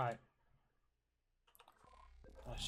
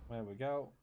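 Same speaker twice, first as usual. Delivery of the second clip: ordinary talk, nothing marked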